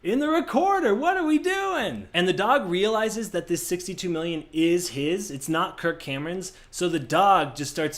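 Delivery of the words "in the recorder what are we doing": high, rising silly panic; dim-witted siblings. Retold or imitated. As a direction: squeal it like clueless rich kids. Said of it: "they're like".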